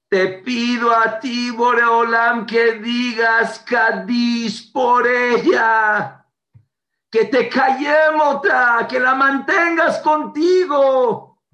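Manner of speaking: slow, high, loud prayer; loud tearful plea. Retold or imitated. Father begging God to say Kadish for his daughter. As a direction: implore God, drawing out each word.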